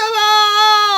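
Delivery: shrieking high laugh; high squeal of laughter. Real, not acted. Laughs at the shot.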